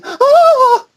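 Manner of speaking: short high mock shriek; a hammed-up startled scream. Nanny screams on finding body.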